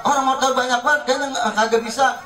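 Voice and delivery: high, whiny complaint; helpless wife. Retold or imitated. Wife complaining she can't.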